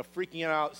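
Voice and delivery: ordinary talk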